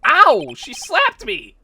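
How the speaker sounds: loud yelp, then indignant complaint; stung and protesting; pained outcry. Acted. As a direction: yelp as if just slapped. Reacting to a slap in game.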